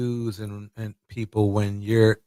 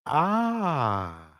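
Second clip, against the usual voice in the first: ordinary delivery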